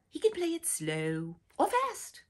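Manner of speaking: ordinary delivery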